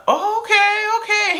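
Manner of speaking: high, taken-aback "okay, okay"; be lost for words; stunned "oh okay". Retold or imitated. Friend hears his confession, stunned and high. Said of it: "my friend was like".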